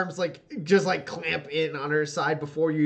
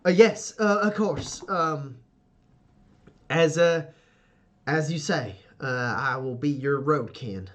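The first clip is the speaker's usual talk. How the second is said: startled compliance; 2 semitones higher, 3 dB louder than usual. Acted. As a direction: snap to attention, stammering through obedience. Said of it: "she, like, snaps up and goes".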